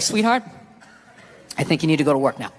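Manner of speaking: low, measured, deliberately calm; controlled calm with a pause after the pet name; retold calm. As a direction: keep it low and even, refusing to take the bait. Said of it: "I just remained calm".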